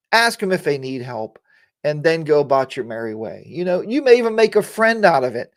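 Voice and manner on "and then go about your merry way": ordinary delivery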